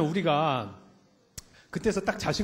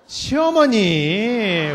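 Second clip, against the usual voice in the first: long, loud, stretched 시어머니 as the punchline; drawn-out answer; 5 semitones higher, 10 dB louder, much slower than usual. Acted. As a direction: drag out 시어머니 loudly for effect. Answers own question, drawn out. (시어머니를 길고 크게 늘여서)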